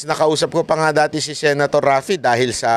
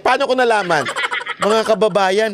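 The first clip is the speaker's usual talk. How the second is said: usual speaking voice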